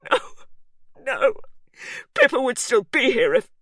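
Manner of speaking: high, loud; grieving anger. A narrator's reading. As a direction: choke out the grief. Hugh grieving.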